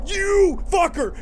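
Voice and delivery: drawn-out high, loud curse; shaken, high-pitched outrage; outraged. Real, not acted. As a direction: yell it slowly at what scared you. Cursing the monster after scare.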